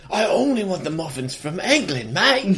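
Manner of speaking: lightly put-on British accent, laughing; a laughing, hammed-up Brit demanding muffins; mock British accent. Podcaster mocking a method actor in a British accent. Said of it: "He's like".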